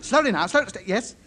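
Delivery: ordinary delivery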